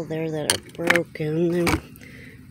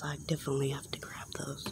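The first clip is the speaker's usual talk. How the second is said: whispering; 3 semitones lower, 7 dB quieter, much faster than usual. Real, not acted. Whispering while dumpster diving.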